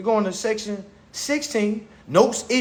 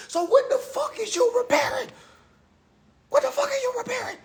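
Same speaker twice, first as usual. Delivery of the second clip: squeaky, much higher voice; high-pitched incredulous; 4 dB quieter than usual. Real, not acted. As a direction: squeal the question in mock disbelief. Mocking credit repair in high squeal.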